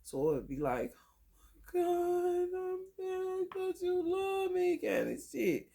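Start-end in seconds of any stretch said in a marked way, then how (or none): 1.7-4.8 much higher, pleading put-on voice; guilt-trip in a whiny high voice; mock needy whine. Mocking a guilt-tripping mate.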